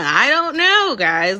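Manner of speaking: high, loud, teasing I-don't-know; mock-innocent shrug to the viewers; sassy shrug. Real, not acted. Loud rhetorical aside to viewers.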